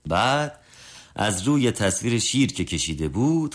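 plain reading voice

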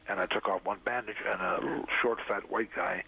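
ordinary talk, nothing marked

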